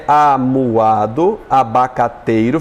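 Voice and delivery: "amuado, abacateiro" drawn out in syllables. Shown as a demonstration. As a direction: slowly sound out "amuado, abacateiro". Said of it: "apresenta a correção".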